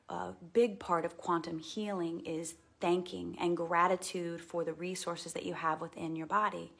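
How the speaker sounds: ordinary talk, nothing marked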